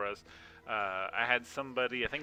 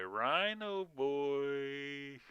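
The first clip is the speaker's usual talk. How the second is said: very slow, drawn-out playful call; sing-song calling; 4 semitones higher, much slower than usual. Acted. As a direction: call him like a lost pet. Calling for his game character.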